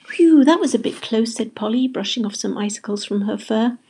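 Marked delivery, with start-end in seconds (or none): 0.1-1.3 higher, louder, relieved exclamation. A narrator's reading. Polly thawing from the ice, relieved. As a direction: let out a big relieved phew.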